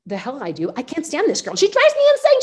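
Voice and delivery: retold rant shooting up high and loud; exasperated outburst. Reacting to saying I love you to her, exasperated outburst. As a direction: build to a hammed-up, high-pitched fed-up yell. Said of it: "my first reaction was".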